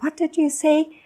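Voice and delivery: high-pitched, mock-baffled query; puzzled catch-up question. Distracted listener to the one talking to them, puzzled. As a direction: play someone who lost the thread.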